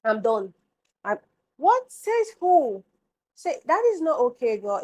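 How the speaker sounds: high, loud incredulous outburst; outraged disbelief. Real, not acted. Vlogger scolding viewers who rewear underwear, in loud outraged disbelief. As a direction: act shocked someone could do that.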